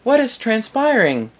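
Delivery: put-on high little girl voice asking. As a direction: pipe up like a small curious girl. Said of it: "like a little girl coming up".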